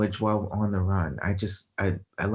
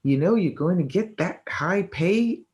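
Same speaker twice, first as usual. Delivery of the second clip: higher-pitched, incredulous questioning; incredulous objection; 6 semitones higher than usual. Retold or imitated. Everyone questioning her life choice, incredulous. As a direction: act out everyone's disbelief, voice lifting.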